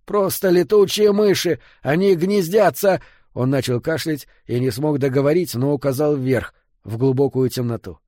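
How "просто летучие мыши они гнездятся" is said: high, strained wheezing cry; hoarse croak. A narrator's reading. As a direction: croak it out urgently, pitched high. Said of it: "прохрипел Фрид". (надрывный хрип)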